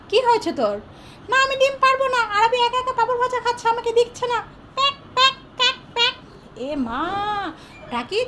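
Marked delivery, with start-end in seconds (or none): 1.3-6.1 high, pinched little duck refusing; sulky cartoon duck whining no. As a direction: play the stubborn duck, pitched up high. Duck Daki complains, quacks.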